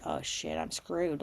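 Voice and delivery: retold muttered moment of doom; sinking dread. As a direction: say it under your breath, defeated.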